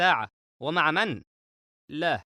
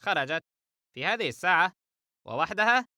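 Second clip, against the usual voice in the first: surprised; 3 semitones higher than usual. Acted. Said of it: "فهد باستغراب".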